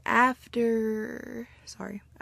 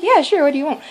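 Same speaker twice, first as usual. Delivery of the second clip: imitated friendly reply; 7 semitones higher, 7 dB louder, faster than usual. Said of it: "And he's like".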